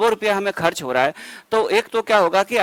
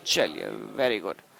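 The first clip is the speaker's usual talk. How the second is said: soft slow praise; 11 semitones lower, 9 dB quieter, much slower than usual. Real, not acted. Teacher praises a student's answer.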